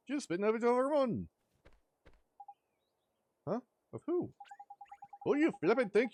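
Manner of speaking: excitable Welsh sidekick, loud and high; chirpy fairy scolding his mate. Acted. Voices Drippy in game dialogue.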